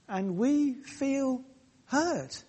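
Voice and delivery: high, slow, soft ache on hurt; tender empathy. Real, not acted. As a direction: draw out we feel hurt gently.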